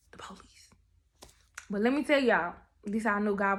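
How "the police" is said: dramatic hushed whisper. Real, not acted. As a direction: whisper the reveal to build suspense. Dramatic reveal to viewers.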